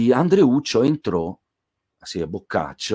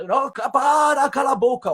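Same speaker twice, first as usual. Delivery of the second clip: put-on angry old woman. Old neighbour yelling at Andreuccio to shut up, high shout. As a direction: snap at the noisy stranger from a window.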